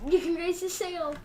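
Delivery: loud, high call to the crew; Viking sailor call. Acted. Friend playing a Viking at sea, calling out.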